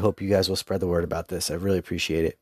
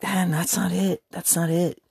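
mock protest; 11 semitones higher than usual. Retelling his own reaction to friend.

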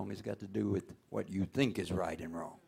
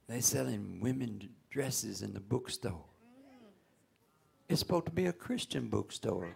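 mock holy person; 3 semitones higher, 5 dB louder than usual. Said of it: "And they say".

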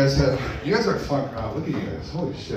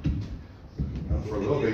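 ordinary delivery

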